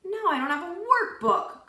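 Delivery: high, loud whiny complaint; Joy whines after losing her workbook; complaining. A narrator's reading. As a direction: whine about the lost workbook. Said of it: "complained Joy".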